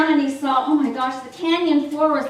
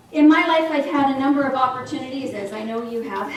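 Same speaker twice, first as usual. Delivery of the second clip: normal talking voice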